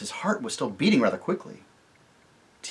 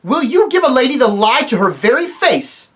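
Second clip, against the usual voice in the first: much higher, loud outrage; indignant king; 12 semitones higher, 11 dB louder than usual. Acted. Tirian rebukes the dwarf, loud and indignant. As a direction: defend the lady's honour. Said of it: "cried Tirian".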